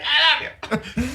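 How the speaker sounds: high, drawn-out lovey cry; mock gushing declaration. Co-host joking about running up to Optimus, mock gushing. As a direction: gush it slowly, half laughing.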